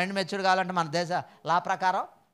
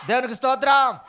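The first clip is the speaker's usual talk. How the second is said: high, loud call of praise to God; 6 semitones higher, 7 dB louder than usual. Real, not acted. Preacher rallying the congregation to praise, shouting loud. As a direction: lift the whole church with a loud shout. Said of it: "ఇంకా గట్టిగా చెబుదాం".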